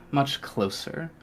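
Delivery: usual speaking voice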